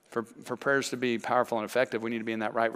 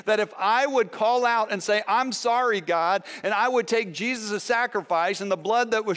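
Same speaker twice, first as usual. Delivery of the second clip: higher and louder, fervent preacher crying out; impassioned pulpit prayer, raised and loud; preaching at full force; 7 semitones higher, 4 dB louder than usual. Acted. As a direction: pour it out like a revival altar call.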